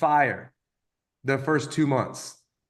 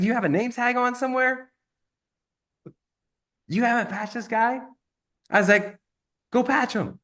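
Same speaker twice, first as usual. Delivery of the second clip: higher, disbelieving retold question; incredulous; 8 semitones higher than usual. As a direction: act amazed he never asked. Speaker retells his own disbelief.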